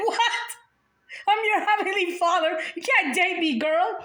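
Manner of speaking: playfully shocked heavenly father; Jesus. Pretend. Jesus scolding the girl who wants to date him. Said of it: "He's like".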